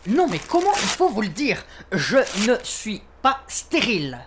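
high-pitched exasperated outburst; worked up. Acted. Suspect correcting the officer about his fertility, worked up. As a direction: insist angrily, stretching out the final words. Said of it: "pas la peine de vous énerver".

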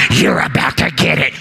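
low, strained mock growl; sneak-attack altar worker. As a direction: growl it like someone jerking a head back. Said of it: "jerk your head back and say".

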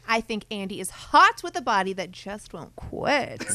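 loud, outraged troll voice; online troll. Acted. Co-host reads Pistolo's comment aloud, loud hammed-up outrage. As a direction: declaim it like an indignant internet commenter, drawing out phrases. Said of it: "in the way we think the online troll would sound".

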